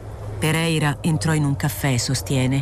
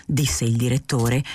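ordinary delivery, nothing marked

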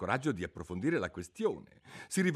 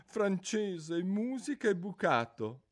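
high, mournful creature voice; a sorrowful old turtle reminiscing; mock turtle voice; 9 semitones higher, 6 dB louder, slower than usual. Mock turtle telling Alice about school under the sea, mournfully.

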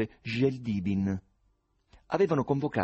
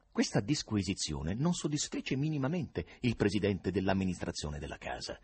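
plain reading voice

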